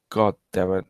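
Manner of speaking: usual speaking voice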